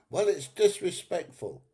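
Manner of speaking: high mock prissy voice; mocking southern woman. Mimicking a southern woman who complained, high and mocking. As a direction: send up the complaining woman, pitched well up. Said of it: "some southern women was going".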